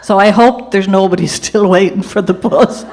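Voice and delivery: laughing. Real, not acted. Joking laugh ending the story.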